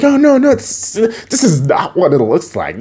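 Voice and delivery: high, flustered protest; mock panicked denial. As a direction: act caught red-handed. Acting Leo caught and denying.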